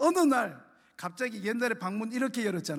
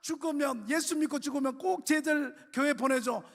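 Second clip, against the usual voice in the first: high-pitched mother begging her own mother; desperate tearful pleading; 7 semitones higher than usual. Retold or imitated. Mother begging grandma to take her to church, tearful plea. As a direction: plead through tears, voice climbing and breaking. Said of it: "막 자꾸 난리를 피웠는데".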